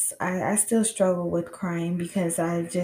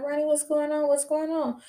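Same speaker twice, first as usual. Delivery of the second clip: impatient friend; 8 semitones higher than usual. Retold or imitated. Friend impatient, waiting in car.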